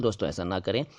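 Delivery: normal talking voice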